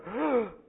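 parent's alarmed gasp. Retold or imitated. Parent sees son holding knife.

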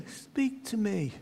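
soft, slow, distant repeat; valley echo repeating the boy. Retold or imitated. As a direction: let it drift back from far off. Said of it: "you could hear an echo".

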